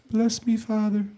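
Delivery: lightly voiced, soft pop star confessing; a hint of a hushed confession in a famous singer's voice. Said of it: "what [name] would sound like".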